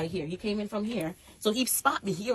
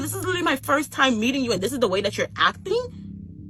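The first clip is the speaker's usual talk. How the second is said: outraged disbelief; 10 semitones higher, 5 dB louder than usual. Retold or imitated. Retold confrontation, high loud.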